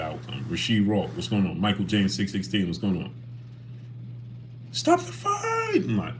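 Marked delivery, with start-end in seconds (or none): 4.7-5.8 very high, drawn-out imitated cry; mock yelled game voice. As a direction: imitate a high, slow yell without volume. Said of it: "somebody's yelling".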